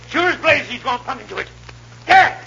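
high, loud gleeful shouting; excited shout. Acted. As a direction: yell it out as it happens. Watching skier hit the wire.

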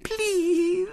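tiny, very high pleading; slow, tearful little-girl wheedle; Violet Elizabeth, quivering. A narrator's reading. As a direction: plead on the edge of tears. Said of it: "in a quivering voice".

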